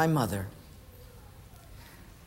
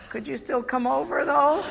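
higher, sheepish little ask; hopeful plea; 7 semitones higher than usual. Retold or imitated. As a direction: slip in a timid hopeful request. Stranger hopes help comes.

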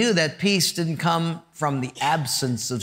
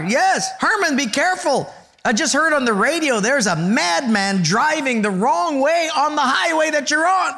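worried wife; 9 semitones higher than usual. Retold or imitated. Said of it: "in a worried voice".

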